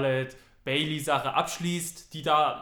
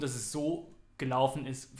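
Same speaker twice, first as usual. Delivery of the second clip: normal talking voice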